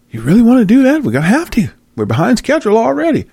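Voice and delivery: high put-on voice of a scheming official; lightly voiced crony; mock officials. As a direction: play the plotting bigwig.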